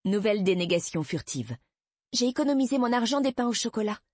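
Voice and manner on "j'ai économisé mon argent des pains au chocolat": voix d'enfant nettement plus aiguë; fillette qui se justifie. A narrator's reading. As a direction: se défendre avec une petite voix haute. Fillette se justifie devant sa mère. (petite fille prise en faute)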